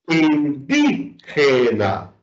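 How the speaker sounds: "indígena" drawn out slowly, stress on "dí". Shown as a demonstration. Teacher giving an example of esdrújulas, slow stress on "dí" in "indígena". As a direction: slowly break up "indígena" and lift "dí". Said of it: "lo lleva en la sílaba di".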